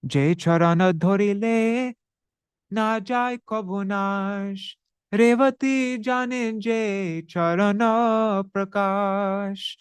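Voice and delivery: slow, measured recital of a Bengali verse; verse recitation. Acted. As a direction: intone the scripture line slowly and evenly.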